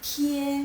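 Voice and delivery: "tie" held high and level; first tone. Shown as a demonstration. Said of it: "Ini nada ke satu".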